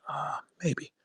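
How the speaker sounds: quiet, put-on hesitant answer; mock his soft hedging; hint of his hushed interview voice; Mickey imitation. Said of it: "and you said".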